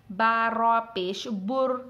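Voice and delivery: slow, full thick बुर; heavy bur. Shown as a demonstration. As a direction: spell out बा रॉ पेश बुर with a heavy रॉ. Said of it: "रा की आवाज़ पुर हो जाएगी". (मोटी आवाज़ में बुर)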